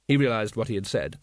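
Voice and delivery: plain reading voice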